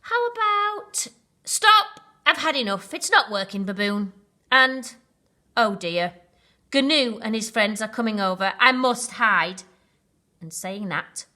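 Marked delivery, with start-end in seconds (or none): 0.0-9.6 panicked. Acted. Rhino fed up, panicking.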